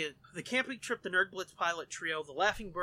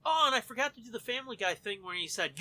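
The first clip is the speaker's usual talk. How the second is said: usual speaking voice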